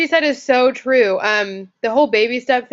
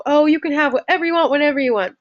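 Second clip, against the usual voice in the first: higher, quicker voice of talking to yourself; self-talk; 6 semitones higher, faster than usual. Acted. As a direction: act out reassuring self-talk, pitched up. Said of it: "You're gonna say to yourself".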